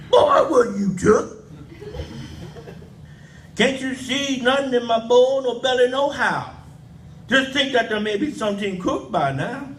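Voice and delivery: lightly voiced granny scolding the boy; granny's real voice. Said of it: "She sound like".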